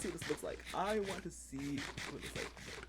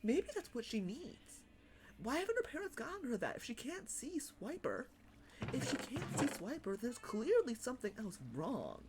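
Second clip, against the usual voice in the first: put-on young kid theorizing; 7 semitones higher than usual. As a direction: puzzle it out like a serious little kid. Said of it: "I distinctly remember going".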